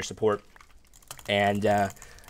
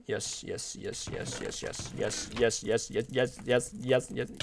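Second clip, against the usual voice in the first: rapid whispered yeses; whispered glee; 9 dB quieter, slower than usual. Real, not acted. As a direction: whisper it over and over under your breath. Stealing resources in game.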